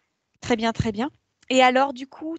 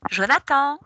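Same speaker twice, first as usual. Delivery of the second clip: raised voice summoning someone; calling out; 4 semitones higher, 5 dB louder than usual. Real, not acted. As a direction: call the name out, higher and louder. Summons Jonathan after counting to three.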